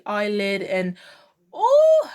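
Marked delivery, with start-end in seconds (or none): 1.5-2.1 very high, loud drawn-out "oh"; high squealed oh of admiration; gushing oh. Real, not acted. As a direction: swoon out loud on a high held note. Gushing over the makeup look.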